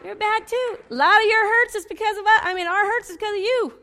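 high, loud mock accusation; teasing scold. Real, not acted. Preacher kidding the men about hurts, teasing scold. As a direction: playfully blame them at full voice.

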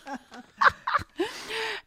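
high, bright laugh; big laugh. Real, not acted. Speaker laughing at her own boat story.

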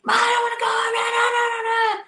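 put-on high, whining kid refusing, shouted; mimicking a whiny child's no no no. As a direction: wail like a kid who won't leave. Kids refusing to leave.

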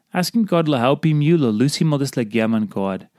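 usual speaking voice

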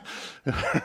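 short breathy laugh; a quick unvoiced chuckle. Real, not acted. Laughs at own joke.

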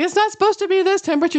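high, fast dog grumble about the cold; complaining dogs. Pretend. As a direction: whine it as a cold dog would. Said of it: "You can almost hear 'em say it in their voice".

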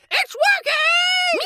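high, slow, gleeful shout; excited shout. Acted. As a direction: crow it out with triumph. Spell starts glowing, shouted again.

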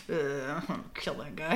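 a hushed murderous aside; half-whispered grumble while retelling; muttered threat. Retold or imitated. Quoting Lee's grim aside.

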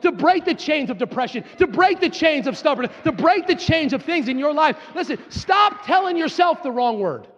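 high-pitched, driving sermon cadence; full-force preaching. Acted. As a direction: preach it urgently, each phrase building on the last.

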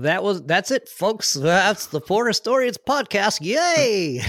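high, loud, slow showman sign-off; bright old-time radio host cheer; old-timey announcer. Pretend. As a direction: ham it up like a vaudeville host. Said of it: "got that old-timey energy".

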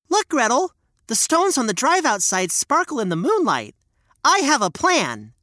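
put-on high, eager little boy; bright child explaining a plan; young boy voice.

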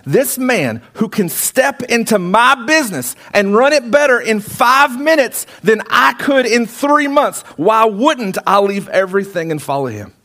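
high-pitched, emphatic acted amazement; impassioned realisation. Peter realises who Jesus is, impassioned amazement.

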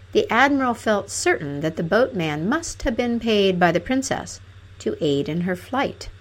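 ordinary delivery

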